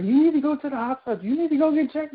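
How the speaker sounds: high-pitched, retold worry; nervous urging. Older woman urging her to hospital, nervous. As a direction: imitate a fretting older woman urging action. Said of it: "she was really, like, nervous".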